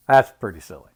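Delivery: plain reading voice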